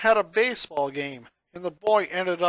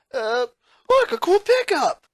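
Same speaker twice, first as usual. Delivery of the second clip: very high, loud pretend delight; fake excitement; 8 semitones higher, 7 dB louder than usual. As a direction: trick them into looking away. Distracting bullies with a trick.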